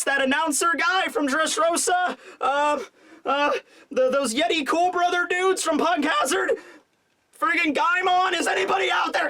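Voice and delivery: high-pitched, building mock panic; Kinemon freaking out. Kinemon getting no answer on the snail, comic freak-out. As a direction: start with hopeful hails, build to pleading desperation. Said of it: "he starts to freak out".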